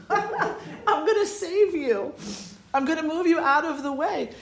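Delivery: high-pitched retold plea, through laughter; playful coaxing. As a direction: coax a tiny bug, half laughing.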